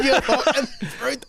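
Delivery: laughing mock insult. Friend mocking the homophobic stranger, laughing.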